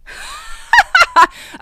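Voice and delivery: very high, loud giggling fit; hysterical laugh. Real, not acted. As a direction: squeal with helpless laughter. Overcome by the news.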